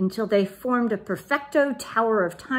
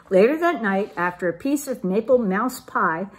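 usual speaking voice

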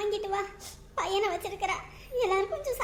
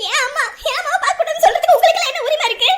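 higher, loud indignant yell; angry shout; 4 semitones higher, 8 dB louder, faster than usual. Acted. Daughter yelling at family barring her, indignant shout. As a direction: demand your right loudly.

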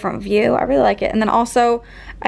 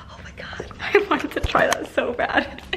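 laughing whisper; 2 semitones higher, 6 dB quieter than usual. Real, not acted. After the puppy's trick, whispered through giggles.

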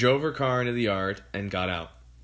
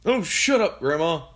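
irritated father; 6 semitones higher, 4 dB louder than usual. A narrator's reading. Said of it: "he said".

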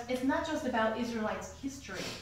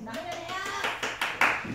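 higher, slow stretched count; stalling mom count; 8 semitones higher, 4 dB louder, much slower than usual. Retold or imitated. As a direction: drag the count out to buy time. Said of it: "your mom is like".

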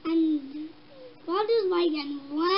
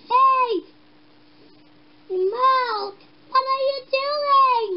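loud, raised-voice challenge; acted, indignant-sounding shout; calling out; 4 semitones higher, 5 dB louder, slower than usual. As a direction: holler at someone across the way. John's acted quote, calling out.

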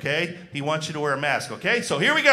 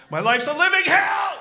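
shouted much higher and louder; hammed-up wail of despair; mock despair shout; 11 semitones higher, 7 dB louder than usual. Comic at the club moaning about his life, loud mock-despairing shout.

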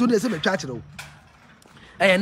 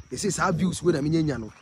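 ordinary talk, nothing marked

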